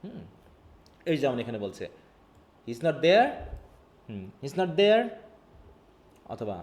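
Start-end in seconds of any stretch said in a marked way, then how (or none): 2.7-3.3 louder, THERE rising as a question; rising question tune. Shown as a demonstration. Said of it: "rising টোনটা হচ্ছে আমাদের". 4.4-5.0 THERE lifted at the end as a question; rising question tune. Shown as a demonstration. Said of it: "rising টোনটা হচ্ছে আমাদের".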